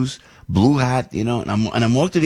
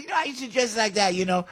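admiring skycap; 10 semitones higher, 6 dB quieter than usual. Retold or imitated. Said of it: "He goes".

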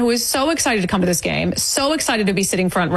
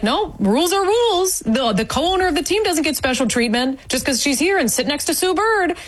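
much higher-pitched, emphatic mock enforcer; imagined firm refusal; 7 semitones higher than usual. Fan voicing what security should have said, emphatic. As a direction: lay down the rules, imitating indignant security.

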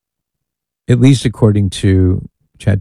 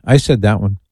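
ordinary talk

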